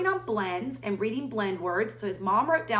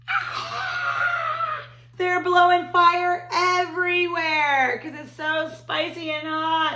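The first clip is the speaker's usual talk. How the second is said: excited alarm; 8 semitones higher, 6 dB louder, much slower than usual. Acted. Dragons blowing fire, excited reading.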